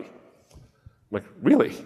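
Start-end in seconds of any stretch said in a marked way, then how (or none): 1.4-1.7 sharp high rising really; incredulous really. Retold or imitated. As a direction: disbelief jumping up on the word. Incredulous reply to absurd claim.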